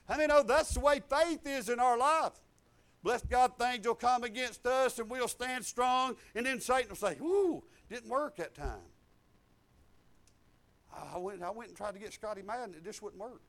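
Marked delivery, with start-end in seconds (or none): 0.1-8.9 full-force preaching. Real, not acted. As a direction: lift the scripture over the crowd.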